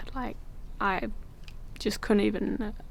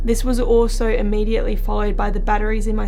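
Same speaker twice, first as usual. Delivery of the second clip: louder, even narrator read; documentary voiceover; 8 dB louder than usual. A narrator's reading.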